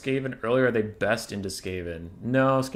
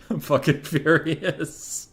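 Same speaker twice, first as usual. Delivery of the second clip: slow, laughing fake rage; mock fury; 6 semitones higher, 4 dB louder, much slower than usual. Streamer joking about opponent's build, mock anger. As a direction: ham up being furious. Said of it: "I'm pissed off".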